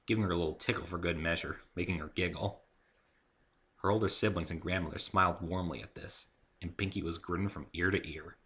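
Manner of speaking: usual speaking voice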